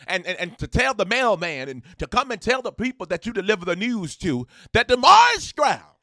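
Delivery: loud, high, building hype; rally everyone like a preacher at full tilt; excited shout-out climbing to a peak; hyped announcement. Real, not acted.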